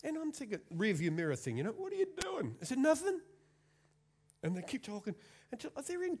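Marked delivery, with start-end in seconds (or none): 1.7-2.5 mock curious call. Calls to kids in back. 2.8-3.2 imitating kid's nothing. Kids' reply voiced.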